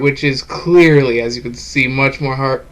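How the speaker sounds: normal talking voice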